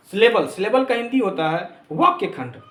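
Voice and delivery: ordinary talk, nothing marked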